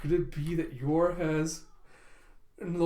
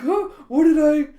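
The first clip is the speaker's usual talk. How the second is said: much louder and higher, startled question; shocked confusion; 9 semitones higher, 12 dB louder than usual. Retold or imitated. Yor after kicking Lloyd flying, loud shocked blurt. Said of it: "And she's like".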